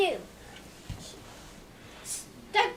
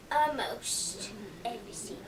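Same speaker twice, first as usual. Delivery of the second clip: low quoted line; 11 semitones lower, 11 dB quieter than usual. Retold or imitated. Quoting the dude.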